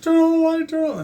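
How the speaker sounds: high, loud desperate yell; frantic scream. Retold or imitated. Terrified kid screaming at brother for the light, frantic. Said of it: "I was screaming".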